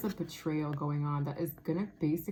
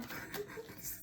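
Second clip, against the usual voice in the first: high breathless laugh; 14 dB quieter than usual. Real, not acted. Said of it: "I don't know if I'm ready".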